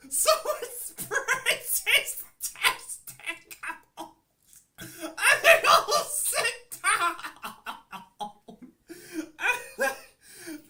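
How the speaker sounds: high, loud, slow words through laughter; crying with laughter telling it; laughing hysterically. Real, not acted. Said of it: "I can't with a straight face".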